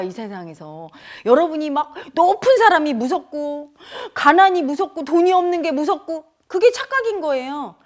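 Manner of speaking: ordinary talk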